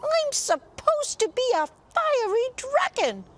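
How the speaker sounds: very high, loud squeaky worm voice; squirming worm. Acted. Dragon turned worm squeaks in outrage, very high. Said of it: "squirmed the worm".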